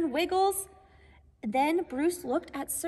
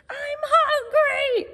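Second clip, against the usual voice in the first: high, loud, slow wail; hungry cry; 9 semitones higher, 5 dB louder, slower than usual. Acted. Searle the squirrel wailing for food, high slow loud cry. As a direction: stretch it out like a starving squirrel. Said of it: "cried Searle".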